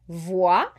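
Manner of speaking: VOIT said "vwah", no T; silent final T. Shown as a demonstration. Teacher giving an example word, silent final T. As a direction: end voit on an open "ah", T dropped. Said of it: "so you don't hear the T".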